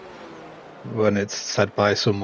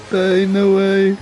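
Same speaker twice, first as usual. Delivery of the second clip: stunned disbelief; 10 semitones higher, 7 dB louder, slower than usual. Real, not acted. Slow disbelief.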